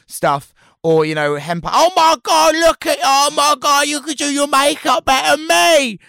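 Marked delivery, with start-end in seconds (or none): 1.7-6.0 high, loud, gushing mum voice; impression of my mum. A drag performer imitates his mum gushing over his makeup, high and loud. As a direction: squeal it like a tipsy mum on a hen night. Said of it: "great impression of my mum there".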